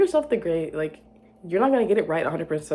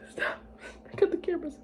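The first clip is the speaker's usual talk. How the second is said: mock flustered; 11 semitones higher, 5 dB quieter than usual. Mock embarrassed high command.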